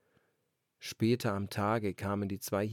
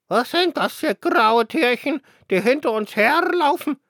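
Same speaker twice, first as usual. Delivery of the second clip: put-on old dove; 13 semitones higher, 13 dB louder than usual. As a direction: ask it like a haughty old dove. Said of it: "fragte eine alte Taube".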